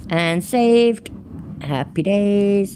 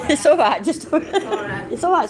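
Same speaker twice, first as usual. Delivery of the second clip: laughing; 7 semitones higher, slower than usual. Real, not acted. Laughing at daughter's interruption.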